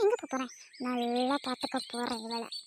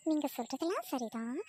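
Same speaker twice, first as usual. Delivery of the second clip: ordinary talk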